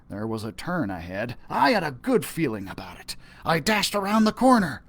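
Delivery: ordinary delivery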